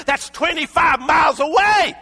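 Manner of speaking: shouted, high preaching cry; full-force sermon shout; fiery preaching. Acted. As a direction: preach it to the rafters. Preaching emphasis.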